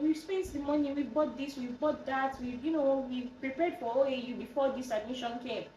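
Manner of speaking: high-pitched retold excuse; retold pleading explanation. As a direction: act out justifying yourself to dad. Explaining to dad about spent money.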